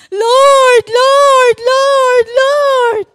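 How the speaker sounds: high, loud crying call to the Lord; child's loud tearful plea, Lord repeated. Retold or imitated. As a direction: cry out desperately to God. Child calling on God in panic.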